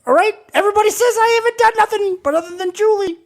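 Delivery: high grandma-like promo voice; wrestling promo. Pretend. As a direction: taunt like a wrestler in an old lady voice. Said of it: "you sound like my grandma".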